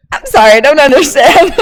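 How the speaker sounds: flustered newcomer apologizing to a cashier; flustered apology. Retold or imitated. Student to grocery cashier, lost and apologetic. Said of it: "I'm like".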